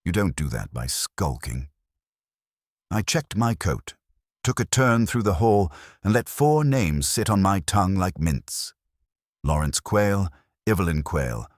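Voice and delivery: usual speaking voice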